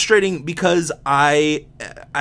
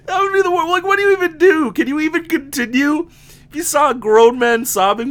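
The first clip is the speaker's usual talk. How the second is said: laughing; 4 dB louder than usual. Real, not acted. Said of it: "still laughing, high voice".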